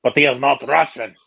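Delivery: loud mock-Russian accent. Ribbing the friend who auditioned, in a mock Russian accent. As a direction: put on a punchy Russian accent. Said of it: "he had a Russian accent".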